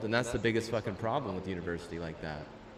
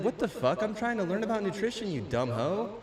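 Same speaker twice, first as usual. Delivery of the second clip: exasperated quote; 9 semitones higher than usual. Retold or imitated. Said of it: "It's like".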